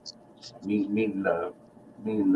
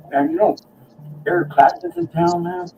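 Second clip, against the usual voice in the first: excited tip-off; 12 semitones higher than usual. Retold or imitated. Telling [name] is in town.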